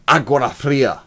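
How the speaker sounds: loud, stern proclamation to the Hive; angry Lord Commander. Acted. Lord Commander addressing the citizens with angry force. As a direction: bellow it like a wrathful commander. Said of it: "I'll try and do the, uh, the angry one".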